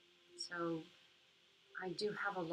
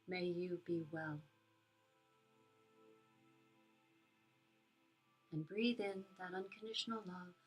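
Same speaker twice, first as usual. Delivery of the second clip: soft spa whisper; 4 dB quieter than usual. Acted. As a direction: guide them gently into calm. Leads a metta meditation.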